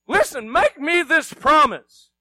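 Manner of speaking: ordinary delivery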